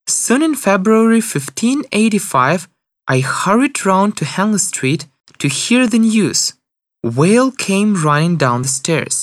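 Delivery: ordinary delivery, nothing marked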